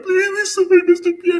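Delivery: very high, loud pleading, hammed-up; mock tearful begging. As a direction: imitate crying kids begging for mercy. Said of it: "even us begging".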